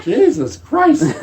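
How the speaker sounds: high, slow "Jesus Christ" through laughter; laughing disbelief. Retold or imitated. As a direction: stretch it out, cracking up in shock. Shocked reaction to general's remark.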